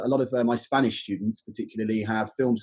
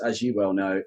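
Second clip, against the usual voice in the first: normal talking voice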